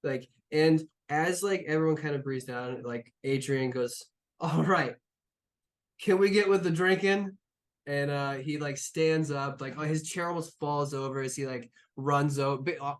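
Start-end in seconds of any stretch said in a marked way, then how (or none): 4.4-7.4 higher, louder, impatient to start drinking; rally the table to get the drinks going; eager call for drinks. Acted. Eager captain calls for drinks.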